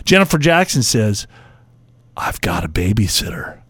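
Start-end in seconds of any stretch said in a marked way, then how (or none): 2.1-3.6 low, soft, intimate murmur; seductive mock murmur. Host reading listeners' romantic lines to his co-host, seductive mock murmur. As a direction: murmur it low like sweet talk. Whispering sexy lines to partner.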